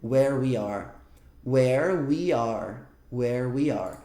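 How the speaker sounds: "Where we are" with every R sounded, low pitch; teacher drilling the Rs; the R sounds. Shown as a demonstration. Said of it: "Parece que fica com muito R aqui".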